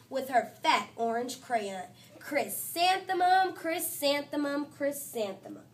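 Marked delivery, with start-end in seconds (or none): none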